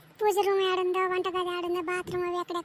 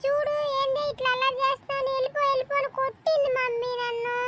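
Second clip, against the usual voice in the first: put-on whiny child; 8 semitones higher than usual. Child complaining to mother about the landlady, higher whine. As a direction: complain to mom like a hurt child.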